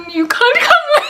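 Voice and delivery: high, squeaky laughing delivery; breaking into laughter. Real, not acted. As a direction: crack up as you get the line out. Cracks up while quoting the ex.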